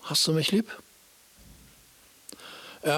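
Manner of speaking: ordinary talk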